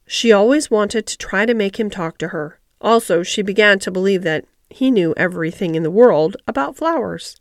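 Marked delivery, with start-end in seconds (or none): none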